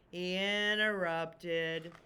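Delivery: very slow, drawn-out answer; slow teacherly reveal to the class; slow emphasis. Shown as a demonstration. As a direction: lay each word down slowly. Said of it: "What did Little Chicken do?".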